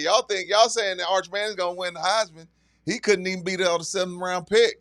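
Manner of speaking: put-on Southern accent. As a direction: imitate a Southern coach. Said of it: "Steve Spurrier said".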